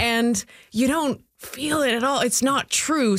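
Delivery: amazed inner voice. Retold or imitated. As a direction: gush with mock wonder. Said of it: "And I was like".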